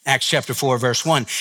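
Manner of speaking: ordinary delivery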